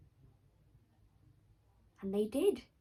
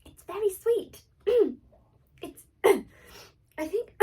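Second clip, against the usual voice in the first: higher, loud, words broken by throat clearing; choking; 9 semitones higher, 13 dB louder than usual. Acted. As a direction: choke on the poisoned apple mid-sentence. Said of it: "She coughed and spluttered".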